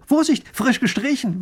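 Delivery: high, loud alarm; urgent warning. A narrator's reading. Painter stopping lady grabbing wet railing, urgent warning. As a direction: call a sudden warning, pitch jumping. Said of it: "warnt er sie".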